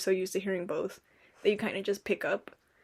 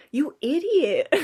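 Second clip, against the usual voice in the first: slow mock-scolding from the family, louder; teasing family; 6 semitones higher, 6 dB louder, slower than usual. Family teasing her bad Spanish, slow and mock-scolding. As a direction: drag it out like teasing relatives.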